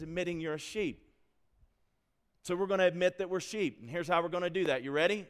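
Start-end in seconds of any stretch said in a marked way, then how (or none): none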